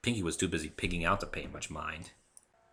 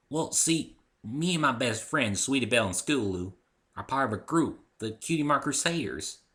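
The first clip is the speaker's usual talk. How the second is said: put-on a bit higher, eager young girl; lightly voiced little filly; 5 semitones higher, 4 dB louder than usual. Apple Bloom telling the narrator about her club, lightly childlike. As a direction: sound like a hopeful kid explaining.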